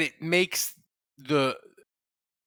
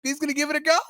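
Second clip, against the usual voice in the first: high, loud playing-coy coach voice; cagey coach, pitched way up; coy coach impression; 13 semitones higher, 6 dB louder than usual. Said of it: "John Payton could have played it coy and been like".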